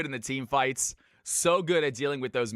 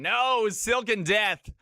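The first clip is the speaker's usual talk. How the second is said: a long, drawn-out, higher mock groan of dismay; 6 semitones higher, much slower than usual. Groaning at the viewer's banned champ, mock dismay. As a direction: ham up the disappointment, stretching the no. Streamer reacts to target ban.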